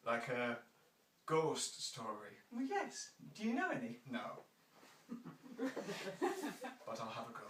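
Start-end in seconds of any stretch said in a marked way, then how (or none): 5.1-6.8 high thin giggle in a put-on voice; playful high-pitched giggle; creaky high giggle. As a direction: giggle like a mischievous creature. Creature giggles.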